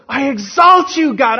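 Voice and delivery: high, loud, drawn-out exultant cry; joyful, exultant praise. Shown as a demonstration. Preacher showing how Simeon really praised God, exultant shout. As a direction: shout praise with your whole heart, pitched high. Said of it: "It was probably".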